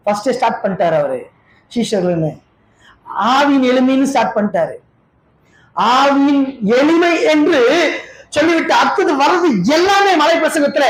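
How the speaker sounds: ordinary talk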